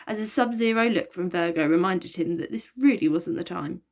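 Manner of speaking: ordinary delivery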